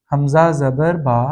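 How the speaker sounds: حمزہ زبر با spelled out slowly, each part separate; slow spelling demo. Shown as a demonstration. Tajweed teacher slowly spelling out a doubled letter. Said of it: "پہلی مرتبہ پڑھتے وقت اس سے پیچھے والے حرف سے ملائیں گے".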